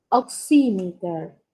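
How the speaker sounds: "oximeter" slowly, second syllable punched: ok-SI-mi-ter; stress on SI. Shown as a demonstration. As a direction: lean hard on the "xi" of "oximeter". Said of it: "Como si llevara una tilde". ("oximeter" despacio, con acento en "si")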